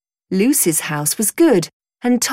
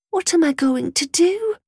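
put-on frightened girl; 9 semitones higher than usual. Lost frightened girl thinks aloud.

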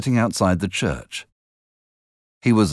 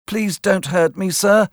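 put-on higher boy's voice, begging for mercy; a lightly acted scared plea; boy begging the convict not to hurt him; frightened boy pleading; 12 semitones higher than usual. A narrator's reading. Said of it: "I cried".

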